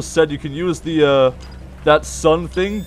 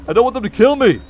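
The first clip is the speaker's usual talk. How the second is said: ordinary delivery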